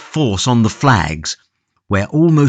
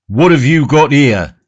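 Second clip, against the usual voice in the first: loud, gruff, high demand; a jailer roaring in a new voice; roaring gaoler; 5 semitones higher, 5 dB louder than usual. Acted. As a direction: bellow it at the prisoner. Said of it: "he roared".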